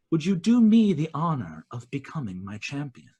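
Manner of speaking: Queen of Dusk asks Demora to be her champion, solemn. Acted. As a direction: ask it gravely, with dark dignity.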